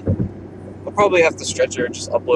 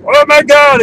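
relieved; 12 semitones higher, 8 dB louder, slower than usual. Retold or imitated. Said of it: "I was like".

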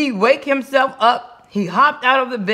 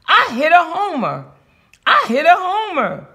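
high-pitched triumphant cry; high, proud crowing; excited holler; 7 semitones higher than usual. A narrator's reading. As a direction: holler the big news on getting home. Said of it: "he hollered".

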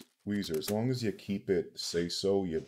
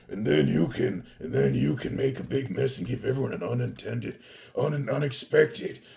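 building hype, louder toward the end; announcer voice; 6 semitones higher, 10 dB louder than usual. Acted. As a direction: sell it like a trailer voiceover. Hypes the channel point redeems.

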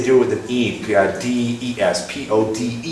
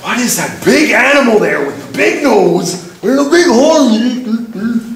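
high, slow puzzled settler voice; curious settler; 9 semitones higher, 4 dB louder than usual. Retold or imitated. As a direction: ask slowly and high, like a baffled newcomer. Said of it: "and they're like".

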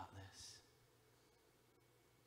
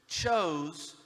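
chose said louder and stretched out; stress on chose; 5 semitones higher, 12 dB louder than usual. Shown as a demonstration. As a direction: lean hard on the word chose. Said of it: "underline this word".

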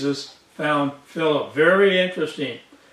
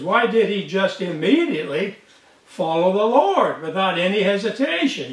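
ordinary delivery

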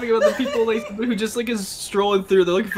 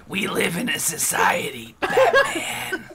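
Joker quote voice; 5 semitones higher, slower than usual. Pretend. Quoting the Joker meme.

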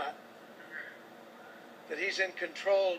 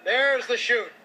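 excited; 5 semitones higher, 5 dB louder, slower than usual. Real, not acted. Commentator sees parachute open.

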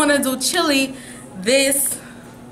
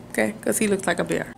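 normal talking voice

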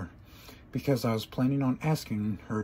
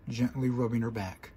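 ordinary delivery, nothing marked

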